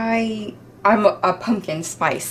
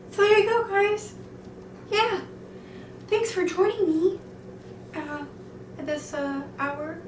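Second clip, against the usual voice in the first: chirpy sign-off; 9 semitones higher, 5 dB quieter than usual. Acted.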